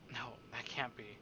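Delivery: breathless whispered denial. Acted. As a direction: whisper it as if afraid to say it. Reading a horrified realization.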